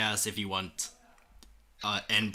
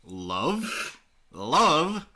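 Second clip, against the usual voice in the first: rising, louder disbelieving echo; angry disbelief; 5 semitones higher, 3 dB louder than usual. Acted. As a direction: spit the word back in anger. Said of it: "getting angrier by the second".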